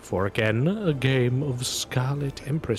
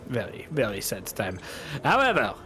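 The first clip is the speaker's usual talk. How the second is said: hammed-up French gambler losing, with a whispered concession; theatrical dismay at a losing hand, lightly accented; Bretonnian accent; 2 semitones higher than usual. Gambler loses the hand and concedes, mock-dramatic accent.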